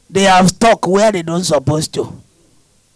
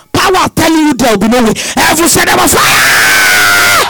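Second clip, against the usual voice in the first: preaching at full force; 4 dB louder than usual. Real, not acted. Preacher declaring fire to the congregation, loud and stretched. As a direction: drive it home and stretch the fire line.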